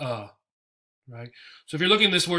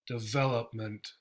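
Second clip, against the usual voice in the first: development. con acento en la sílaba vel; stress on vel; 4 semitones lower, 7 dB quieter than usual. Shown as a demonstration. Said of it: "And the stress is in the vowel here".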